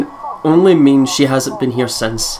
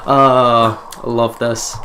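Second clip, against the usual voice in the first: savoring; 3 dB louder, much slower than usual. Real, not acted. Savoring the brand name.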